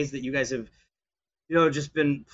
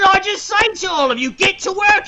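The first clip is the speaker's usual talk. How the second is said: high, loud yelling order at the crew; angry boss yell; 8 dB louder than usual. Acted. Pirate boss yells the crew back to work, angry shout. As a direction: crack it like a whip-happy slaver.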